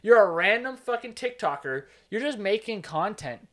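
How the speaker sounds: loud, high scoffing retort; exasperated rant. Retold or imitated. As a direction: tell off a fake guru, loudly. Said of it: "And I'm like".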